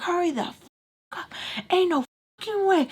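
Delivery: high-pitched, fast angry burst; livid. Retold or imitated. Tommy snapping at the girls to hurry, high and fast. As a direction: spit it out like she's fed up. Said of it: "she's so livid".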